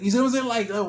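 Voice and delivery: exclaiming to the viewer; excited emphasis. Real, not acted. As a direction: drive the point home with excitement. Excited about world changes.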